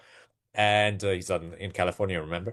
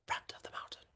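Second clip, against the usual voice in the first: breathy whispered aside, higher pitch; 17 dB quieter, faster than usual. Shown as a demonstration. Trading teacher to viewers, whispered under breath. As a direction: murmur it under your breath like a secret. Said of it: "All right, I'll say that loudly".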